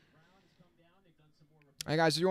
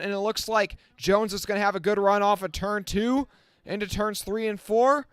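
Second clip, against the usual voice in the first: brisk, raised race-calling voice, a touch higher; live play-by-play; 4 semitones higher than usual. Acted. Streamer calling the race for his viewers, lively play-by-play. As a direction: call the restart like the race is on the line.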